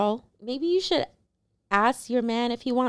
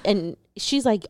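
ordinary delivery

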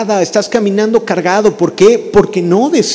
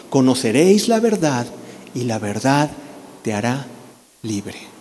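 softly fading scripture; 5 semitones lower, 5 dB quieter than usual. Acted.

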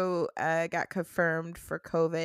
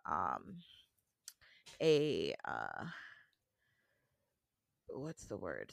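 groggy hungover mumble; 12 semitones lower, 9 dB quieter, slower than usual. Real, not acted. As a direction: sluggish and hungover, searching for words. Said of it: "I am hungover".

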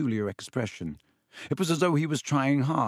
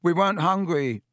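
higher, louder protest; loudly; 6 semitones higher, 4 dB louder than usual. A narrator's reading. Boy insisting to the teachers, loudly. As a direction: cover up with volume. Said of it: "said Ron loudly".

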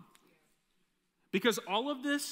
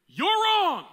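much higher, much louder blunt accusing snap; harsh accusation; 13 semitones higher, 12 dB louder than usual. Retold or imitated. Wife bluntly tells her husband he is wrong, loud accusation. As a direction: blast it like a harsh truth-teller. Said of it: "She wouldn't say it like that".

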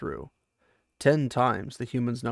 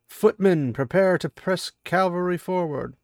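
loud, high order bellowed across a battlefield; a general shouting orders over the din of battle; commanding battlefield shout; 5 semitones higher, 5 dB louder than usual. Acted. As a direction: call the order so the whole line hears it. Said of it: "In a voice that carried over the tumult below".

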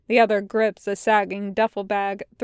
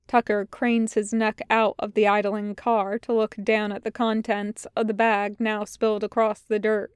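usual speaking voice

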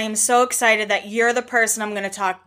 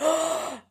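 sharp startled gasp, softer; a quick waking gasp, pitched up; imitated gasp; 6 semitones higher, 6 dB quieter than usual. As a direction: mimic jolting awake with a gasp. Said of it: "sit up straight and be like".

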